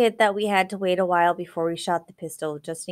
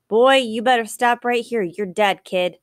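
menacing threat; 5 semitones higher, 6 dB louder than usual. A narrator's reading. Sanders threatening the fleeing boy.

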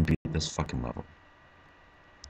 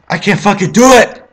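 screamed, far higher and louder; raging scream; 13 semitones higher, 16 dB louder than usual. Real, not acted. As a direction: scream it at the screen. Screams after failing again.

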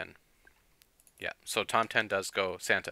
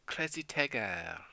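put-on French accent; 3 semitones higher, 5 dB quieter, slower than usual. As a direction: imitate the French robot voice. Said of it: "A French accent".

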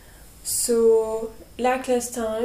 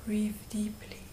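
guided meditation; 9 dB quieter than usual. Real, not acted. Guide leading listeners through breathing and calling on angels, hushed meditation voice. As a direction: gently guide them, leaving long silences between phrases.

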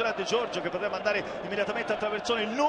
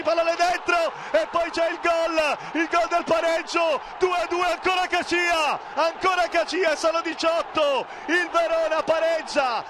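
breathless italian goal commentary; 4 semitones higher than usual. Real, not acted. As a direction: roar the equaliser out. Equaliser, shouted goal call.

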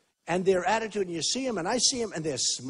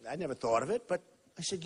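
usual speaking voice

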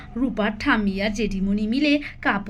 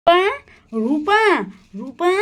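calling out; 6 semitones higher, 4 dB louder than usual. Acted. Mother calling out to child.

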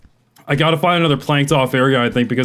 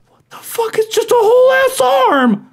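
much higher, louder, slow stunned cry; shocked disbelief; 5 dB louder, slower than usual. Real, not acted. Gamer finding a severed arm, shocked high cry. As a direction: exclaim as if you can't believe it.

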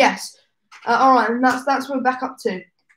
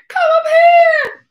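much higher, louder, slow calling out; calling parents; 8 dB louder, slower than usual. Retold or imitated. Parents calling their son up the stairs, high and loud. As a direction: call him upstairs in a sing-song shout. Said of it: "And they went".

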